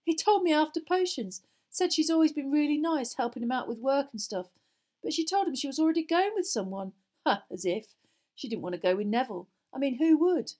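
put-on high, loud, gossiping boy; lively and mocking, in character; amused boy. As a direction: relay the gossip with scornful amusement. Narrator voices amused boy gossiping.

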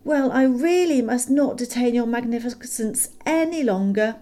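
polite then cheeky farewell; cheeky parting shot. A narrator's reading. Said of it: "he added as a parting shot".

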